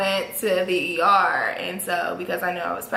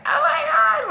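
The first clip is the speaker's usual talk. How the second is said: very high, louder shocked gasp of words; shocked cousin; 4 dB louder than usual. Retold or imitated. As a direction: squeal it in disbelief. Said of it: "And she's like".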